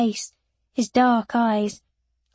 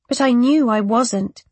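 ordinary delivery, nothing marked

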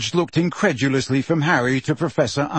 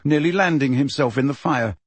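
usual speaking voice

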